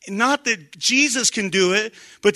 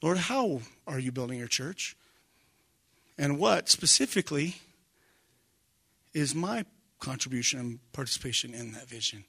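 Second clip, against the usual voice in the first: quiet prayer; 5 semitones lower, 7 dB quieter than usual. Retold or imitated. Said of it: "take the time to say".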